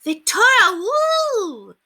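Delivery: put-on very high, loud whooping children; excited howl. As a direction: howl the name with glee. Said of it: "the children howled excitedly".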